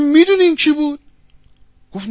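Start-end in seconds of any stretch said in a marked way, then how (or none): 0.0-1.0 loud, high, urgent question; acted confrontation. Bystanders confronting the butcher about the stranger, loud and urgent. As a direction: hit him with it, raised voice.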